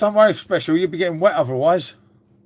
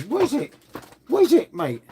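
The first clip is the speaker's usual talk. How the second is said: talking to the dog; 7 semitones higher than usual. Real, not acted.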